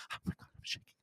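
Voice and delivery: breathless whispered panic, imitating a starstruck fan; mock starstruck whisper. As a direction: whisper it like a trembling fan who can't breathe. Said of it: "she was just".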